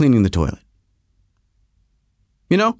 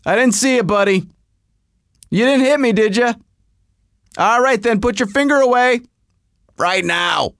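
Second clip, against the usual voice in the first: a hint of a tough-guy stare-down, higher; 8 semitones higher than usual. Facing down the irate driver, mild tough-guy swagger. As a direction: talk him down like a cocky tough guy.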